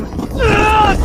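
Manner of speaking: long raw scream of despair; anguished scream. Acted. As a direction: howl in agony. Anguished outcry to heaven.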